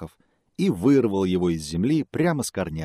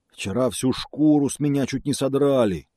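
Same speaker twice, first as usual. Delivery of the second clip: complaining bear; 6 semitones higher, 4 dB louder than usual. Acted.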